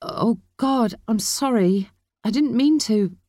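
high, stricken apology; horrified at what was just done; shocked apology. A narrator's reading. Shocked apology after slapping niece.

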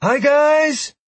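high, loud cheerful call; Galmine calling out. Acted. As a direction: call out from far away. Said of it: "Galmine called from her spot".

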